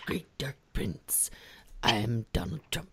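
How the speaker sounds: low whispered cult leader; slow hushed cult leader drone. Retold or imitated. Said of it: "doing that thing".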